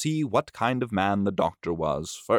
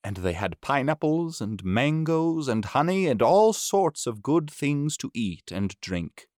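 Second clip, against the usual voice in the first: ordinary delivery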